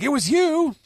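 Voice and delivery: high loud shocked cry; shocked crew. Retold or imitated. Crew seeing Gaimon, high loud shocked cry. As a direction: cry out at the reveal. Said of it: "they're like".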